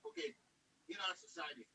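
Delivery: words fading out on a bad phone line; breaking up mid-sentence; voice going in and out. Real, not acted. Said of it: "your voice is going in and out".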